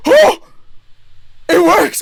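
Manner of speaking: high, loud amazed cry; astonished joy. Retold or imitated. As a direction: burst out in amazed delight. Sale surprise.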